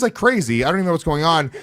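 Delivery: ordinary talk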